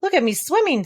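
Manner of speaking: high, excited little squeak; squeaky Roo. Acted. Roo shows off swimming to everyone, high squeak. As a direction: squeak it out like a proud baby kangaroo. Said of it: "squeaked Roo".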